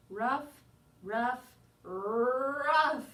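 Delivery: rough growing into a bark; barking words. Acted.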